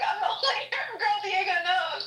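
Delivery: high, loud laughing comeback; laughing retort. Retold or imitated. Woman retelling her comeback to Diego, laughing high and loud.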